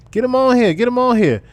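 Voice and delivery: high, loud sing-song call; playful fisherman's call; mock reeling call. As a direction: haul him in like a fish on the line.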